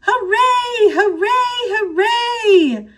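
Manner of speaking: high, loud whoop of joy; cheering class. A narrator's reading. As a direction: cheer like a whole class of excited kids. Class cheers long vacation.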